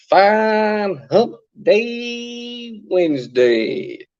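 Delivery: high, slow, sing-out hump day greeting; drawn-out greeting. Real, not acted. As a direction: stretch the words out high and long. Drawn-out livestream greeting to viewers.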